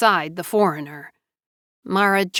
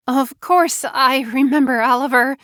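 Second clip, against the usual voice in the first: higher-pitched, anxious reply; 6 semitones higher, 4 dB louder than usual. A narrator's reading. Wife facing the man who could destroy her life, anxious. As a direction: answer too readily, pitched up with nerves. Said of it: "Jo Beth said anxiously".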